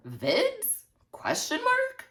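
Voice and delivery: very high rising question; baffled questioning. Retold or imitated. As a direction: pitch it way up in bewilderment. Baffled text reply read aloud.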